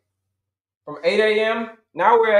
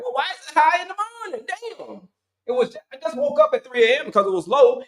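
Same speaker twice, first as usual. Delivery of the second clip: much higher, fast, incredulous whine; exasperated self; 6 semitones higher than usual. Retold or imitated. Diabetic seeing high morning sugar, much higher, exasperated. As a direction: squeal in disbelief at the morning number. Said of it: "You're like".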